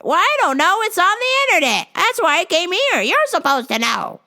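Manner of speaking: put-on high, loud fussy old lady; high customer voice. Old customer complaining to the clerk, high fussy whine. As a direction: whine about the vitamins.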